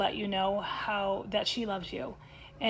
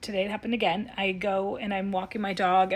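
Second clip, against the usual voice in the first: ordinary delivery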